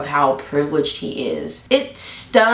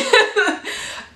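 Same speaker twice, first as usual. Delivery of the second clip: high amused giggle; laugh. Real, not acted. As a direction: break into a light giggle. Laughs after blunt verdict.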